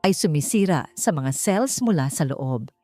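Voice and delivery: plain reading voice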